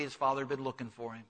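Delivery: usual speaking voice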